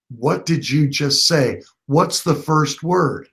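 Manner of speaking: acting out a patient, pointed prompt; deliberate prompting. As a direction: coax the answer out, word by slow word. Retold coaching toward a realisation.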